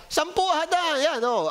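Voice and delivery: ordinary talk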